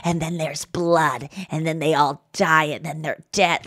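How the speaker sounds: low, soft, slow child impression; imitated little boy. Imitating a five-year-old describing his drawings, low and slow. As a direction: draw it out slowly and softly. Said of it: "he's, like, always lighthearted about it".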